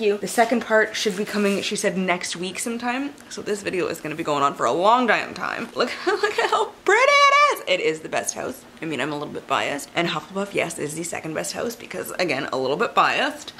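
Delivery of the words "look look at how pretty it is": high, laughing delighted gush; excited gush. Real, not acted. Showing the new Slytherin book to viewers, excited high gush. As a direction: show it off, thrilled and laughing.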